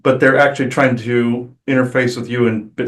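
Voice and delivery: ordinary talk, nothing marked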